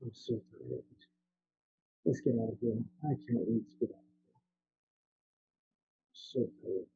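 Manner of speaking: weary, tired. Acted. Weary character ready to leave.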